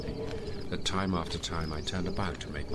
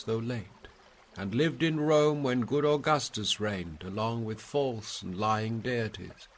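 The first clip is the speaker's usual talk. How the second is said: dignified ancient poet, proud and sonorous, louder and higher; Virgil's ghost; 8 semitones higher, 5 dB louder than usual. Acted. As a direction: speak as the noble shade of Virgil, grand and questioning.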